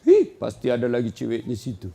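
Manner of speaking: a jealous wife's sneer with a laugh; suspicious wife. Acted. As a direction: accuse him of cheating. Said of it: "mulai sangka buruk".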